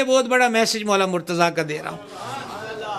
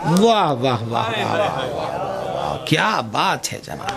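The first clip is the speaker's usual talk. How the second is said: drawn-out awed praise; 6 semitones lower, slower than usual. Real, not acted. Preacher savours the saying.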